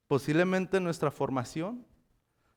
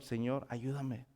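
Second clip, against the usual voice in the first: soft slow plea; 2 semitones lower, 10 dB quieter than usual. Shown as a demonstration. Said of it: "gritarle al Señor".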